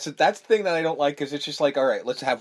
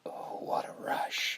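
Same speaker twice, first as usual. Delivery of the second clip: slow whispered relish, imitating a villain; hushed, creepy mock ecstasy; 10 dB quieter, slower than usual. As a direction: whisper it slowly as if savoring a high. Freddy's creepy pleasure in retold scene.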